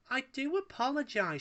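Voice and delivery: high purring lady's voice. A narrator's reading. Paella purrs an apology to Wallace. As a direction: purr it sweetly at him. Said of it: "she purred".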